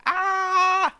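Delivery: sudden high yelp; startled cry. Acted. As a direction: cry out in surprise. Baker startled.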